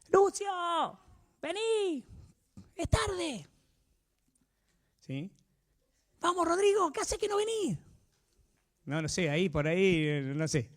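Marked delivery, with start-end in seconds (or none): 0.1-3.5 voz de madre aguda llamando desde lejos; llamá al nene como una madre desde la puerta; mamá llamando a gritos. Retold or imitated. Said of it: "Les pegaba el grito". 6.2-7.8 voz de madre aguda llamando desde lejos; llamá al nene como una madre desde la puerta; mamá llamando a gritos. Retold or imitated. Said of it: "Cómo los llamaba la mamá".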